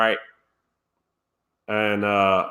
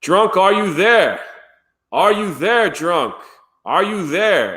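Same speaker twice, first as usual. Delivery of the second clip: bad Australian accent, high and loud; slow, hammed-up Aussie calling out for someone; mock Australian accent, sing out the question; Robot Head; 7 semitones higher, 3 dB louder, slower than usual. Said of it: "That's the worst Australian accent".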